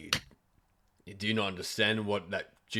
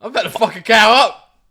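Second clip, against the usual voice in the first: high, shouted threat; hammed-up loud indignation; mock outrage; 13 semitones higher, 18 dB louder than usual. Player threatening the rude shopkeeper, mock outrage.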